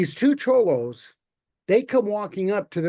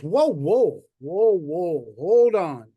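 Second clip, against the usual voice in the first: God stopping him; 4 dB louder, slower than usual. Acted. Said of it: "And God goes".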